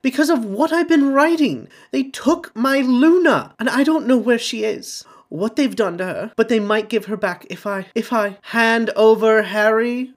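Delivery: put-on high, loud, pleading old man; desperate father. A narrator's reading. Xenophilius begs Harry to stay, high and desperate.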